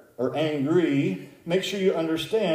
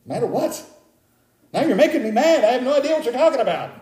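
high, hurried, baffled protest; mock exasperation; 9 semitones higher, 3 dB louder, faster than usual. Preacher replaying his reply to the caller, mock exasperation. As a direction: protest as if wrongly accused, half laughing.

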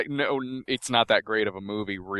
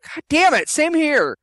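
much higher, louder exasperated groan; frustrated; 5 dB louder than usual. Real, not acted. Friend also forgets the name, pitched-up frustration. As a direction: throw up your hands at a forgotten name.

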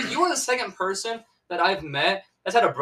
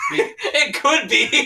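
laughing; 8 semitones higher, much slower than usual. Real, not acted. Laughing high retort.

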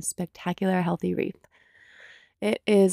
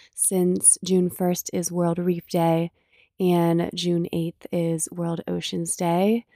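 ordinary talk, nothing marked

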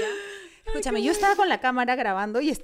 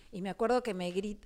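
normal talking voice

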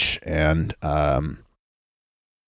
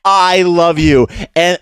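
put-on loud, high, slow drunken gushing; drunk girl yelling; 9 semitones higher, 5 dB louder, slower than usual. Mimicking the drunk girl shrieking at her friend, loud and high. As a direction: slur it out like a wasted spring breaker. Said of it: "yelling, scr-, like".

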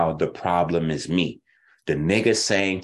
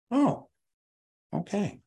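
exaggerated mock surprise; 12 semitones higher, 4 dB quieter than usual. Real, not acted.

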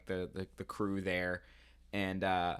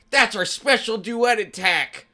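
high, loud cartoon hero shout; triumphant battle call; 9 semitones higher, 4 dB louder than usual. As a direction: call out the team move with gusto. Acting Logie's triumphant battle call.

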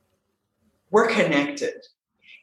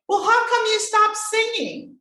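brother's question. Retold or imitated. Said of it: "confess and say".

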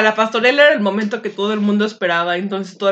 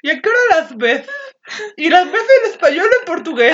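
higher, laughing mock of an over-keen girl; mock eager teen; 8 semitones higher than usual. Sister mocking Vero's nervous texting, higher laughing mock. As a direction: tease her by playing the eager chatter, laughing. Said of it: "¿Y tú".

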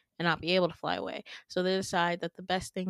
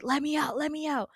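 high but restrained mock scream; panicked pleading without the volume; acted desperate cry, held back; retold screaming; 7 semitones higher, faster than usual. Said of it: "and just screaming".

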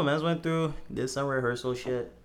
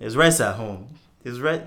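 put-on half Nigerian accent, louder; 10 dB louder than usual. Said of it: "you have to do it in a Nigerian accent".

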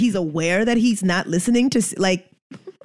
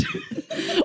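a high squeal of laughter; high laughing squeal; 7 dB quieter than usual. Real, not acted. Laughing at rock hard.

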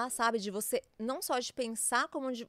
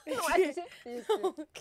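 laughing; 6 semitones higher than usual. Real, not acted. Teasing friend about Roman numerals.

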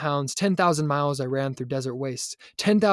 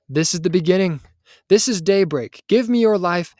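raised, loud, emphatic voice of God; triumphant divine proclamation; 4 semitones higher, 7 dB louder than usual. A narrator's reading. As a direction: proclaim a new beginning with building force. Divine voice answers despair with promise.

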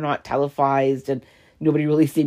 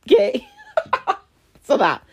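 squeaky laughing okay; 3 dB louder than usual. Real, not acted. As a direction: laugh through the word. Laughing at her own stumble.

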